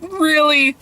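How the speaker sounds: high-pitched, drawn-out "really"; first, highest step of repeated disbelief; incredulous. Acted. Incredulous reaction, repeated stepping down.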